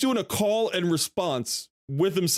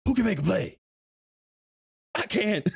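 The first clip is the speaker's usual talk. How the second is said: hyped call; 6 semitones higher than usual. Retold or imitated. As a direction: lift it into a real rally cry. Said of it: "in the back of my head".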